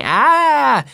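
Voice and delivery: put-on loud, high "ah" copying a kid; imitated scream. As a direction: mimic the little brother's shriek.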